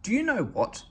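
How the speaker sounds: high eager little engine; Percy voice. A narrator's reading. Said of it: "asked Percy".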